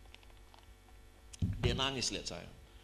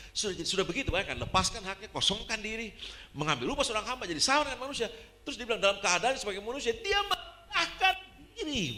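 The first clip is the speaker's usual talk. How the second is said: rising sermon climax; impassioned, escalating proclamation; building fervent preaching; 8 semitones higher, 4 dB louder than usual. Acted. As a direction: climb higher and louder to a long, stretched peak.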